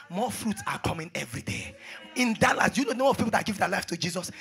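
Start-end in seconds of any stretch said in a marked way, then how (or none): 2.1-4.3 loud declaration building to a shout; full-force preaching. Real, not acted. Preacher declaring souls coming to Jesus, full-force preaching. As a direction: proclaim it high and hard.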